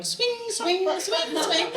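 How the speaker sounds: high singsong swoops; imitated swinging. Storyteller mimics the lampshade swinging by itself, high swoops. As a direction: swoop each word high like a swinging lamp.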